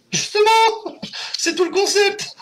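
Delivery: playful falsetto retort; high squeaky protest. Acted. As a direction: jump way up high and stretch out justement. Mock high protest to viewers. (voix de fausset taquine)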